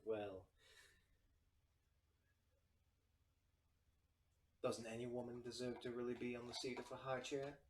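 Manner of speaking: lightly voiced feminine tiefling; a hint of a poised would-be councilwoman; soft feminine voice. Tiefling host defends wanting a council seat, lightly feminine voice. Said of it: "you hear this soft feminine voice".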